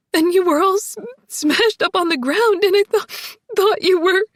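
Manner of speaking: high, loud, breaking with sobs and stutters; crying. A narrator's reading. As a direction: fight through tears, words cracking off. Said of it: "Breaking off ... another burst of crying".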